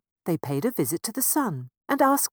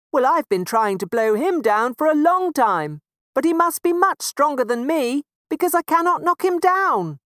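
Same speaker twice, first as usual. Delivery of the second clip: much higher, louder blustering wind; big blustery wind voice; 10 semitones higher, 10 dB louder than usual. Acted. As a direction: boom out like the wind. Wind admits defeat.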